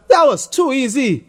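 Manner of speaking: high, smug brag; lightly voiced cocky boy; boasting. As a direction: crow about it. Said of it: "Tony boasted".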